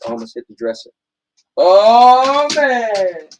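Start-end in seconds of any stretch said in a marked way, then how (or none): 1.6-3.3 oh man while spinning round; spinning in office chair. Acted. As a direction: call it out mid-spin in the chair. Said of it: "I don't have enough space".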